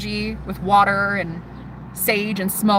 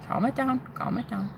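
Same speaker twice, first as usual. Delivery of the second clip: calming; 2 semitones higher, 10 dB quieter than usual. Retold or imitated. Moderator calming the other.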